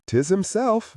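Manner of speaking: a mocking, bad stab at an Irish brogue; terrible Irish accent. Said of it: "in a disgraceful travesty of an Irish accent".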